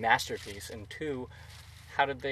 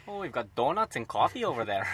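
put-on Minnesotan accent; 3 semitones higher, 6 dB louder than usual. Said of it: "literally what they sounded like".

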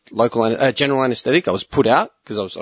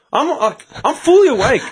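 higher and louder, incredulous; exclaiming; 12 semitones higher, 3 dB louder than usual. Retold or imitated. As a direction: stress being wide awake. Retelling panic during surgery.

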